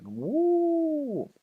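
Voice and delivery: drawn-out high "woo"; long high whoop. Real, not acted. Gamer awaiting an off piece roll, high whoop. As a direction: let out a thin, soaring whoop.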